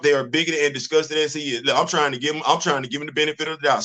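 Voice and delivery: ordinary talk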